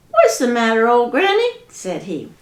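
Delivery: high critter voice; high-pitched friendly groundhog. Acted. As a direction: squeak it out like a small groundhog.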